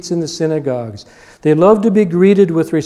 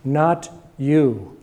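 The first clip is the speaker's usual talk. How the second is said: ordinary talk, nothing marked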